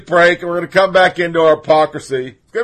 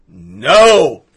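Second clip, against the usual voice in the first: emphatic no; 4 semitones lower, 7 dB louder than usual. Real, not acted. Answering own rhetorical question.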